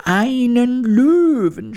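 very high, drawn-out squeal of horror; horrified cry. A narrator's reading. Mouse queen hears there is a lion, crying out horrified. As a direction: squeal it in alarm, stretching the words. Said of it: "schrie die Königin entsetzt".

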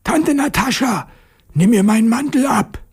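high, strained schoolboy call. A narrator's reading. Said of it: "und rief". (hoch und angestrengt gerufen)

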